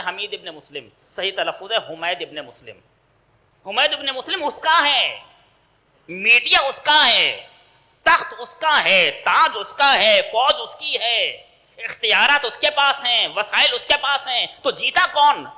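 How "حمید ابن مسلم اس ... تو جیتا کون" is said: preaching at full force. Acted. Preacher builds the list.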